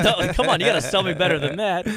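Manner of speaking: loud, laughing through the words; laughing protest. Real, not acted. Host laughing as she jokingly demands a better pitch, loud and laughing.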